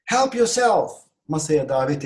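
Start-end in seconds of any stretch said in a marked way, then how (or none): 0.0-0.9 loud, high invitation; exclamation. Shown as a demonstration. Teacher models inviting guests to the table, exclaimed. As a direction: call guests to dig in. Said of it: "Böyle bir ses çıkarmanız".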